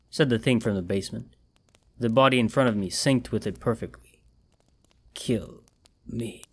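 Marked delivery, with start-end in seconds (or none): 5.1-6.4 much softer, fading away; eerie faint voice. A narrator's reading. Corpse begging to be killed, faint. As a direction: let it die on your breath.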